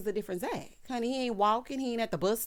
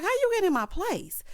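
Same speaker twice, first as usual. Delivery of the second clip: alarmed confrontation; 12 semitones higher, 5 dB louder than usual. Retold or imitated. Retells a startled confrontation.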